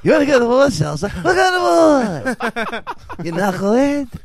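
high laughing Stooge shout. Retold or imitated. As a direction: yell it like Moe picking a fight.

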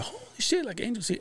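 high, soft squeal of surprise; mock amazement. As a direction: imitate astonished kids in a high voice. Kids' surprise at seeing him, imitated.

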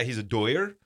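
"Doyer" said as DOY-er, no d in the middle; LA pronunciation. Shown as a demonstration. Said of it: "that's how they say it in L A".

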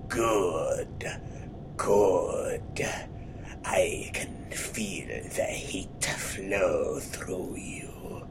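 low sinister whisper, slow; creepy dark whisper. Retold or imitated. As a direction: breathe it like a dark lord. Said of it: "I was like".

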